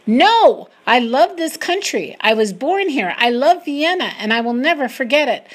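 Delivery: high, loud, emphatic protest; yelling back. A narrator's reading. She refuses the men ordering her to leave, yelling back. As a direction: shout back with fierce insistence. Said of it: "I yell back".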